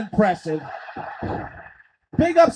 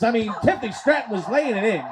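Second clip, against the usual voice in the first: usual speaking voice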